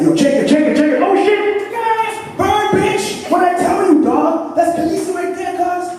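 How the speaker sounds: high, loud, hyped-up shouting; hyped fan. Retold or imitated. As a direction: yell it like a fan losing it at the TV. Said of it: "The energy is just like".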